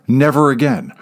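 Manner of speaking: ordinary delivery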